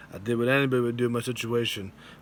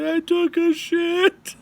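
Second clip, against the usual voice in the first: squeaky confession; 4 dB louder, slower than usual. Retold or imitated. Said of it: "I'm like".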